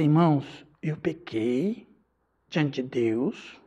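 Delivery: very slow, low and soft repentant line; solemn confession. Shown as a demonstration. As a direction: model a humble confession, slowly. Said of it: "você vai falar assim". (confissão solene, lenta e baixa)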